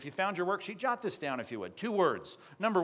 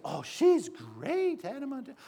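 mock preteen fangirl; 8 semitones higher than usual. Preacher mimicking little daughters adoring Hannah Montana, mock gushing. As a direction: gush like a starstruck preteen. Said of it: "All your little pre-adolescent daughters".

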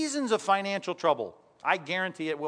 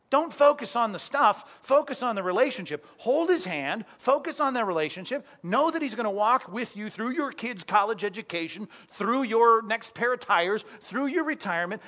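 higher, louder emphatic preaching; preaching at full force; 5 semitones higher, 4 dB louder than usual. Acted. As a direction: drive the point home to the congregation. Preaching climax.

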